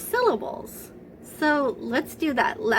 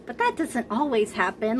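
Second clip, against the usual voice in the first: ordinary delivery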